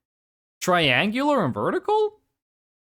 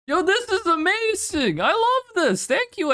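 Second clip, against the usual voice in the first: high, gushing real delight; excited praise; 10 semitones higher than usual. Real, not acted. Streamer thanking Alien for the art, high delighted gushing. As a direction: squeal with love for it.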